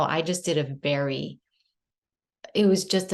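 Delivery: normal talking voice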